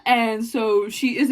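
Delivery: normal talking voice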